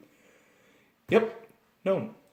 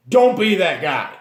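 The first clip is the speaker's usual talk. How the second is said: ordinary talk, nothing marked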